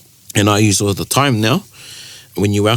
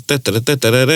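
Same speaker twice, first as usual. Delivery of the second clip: spoken rat-a-tat drum roll; mock drum roll; 3 semitones higher, faster than usual. Announcing the waiata of the week, mock drum roll. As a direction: build suspense with a vocal drum roll.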